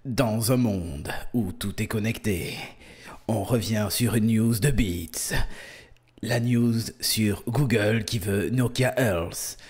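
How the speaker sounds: slow, low epic trailer narration; movie trailer voice. Acted. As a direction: announce it like a blockbuster trailer. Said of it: "comme le mec qui refait les voix de bande-annonce".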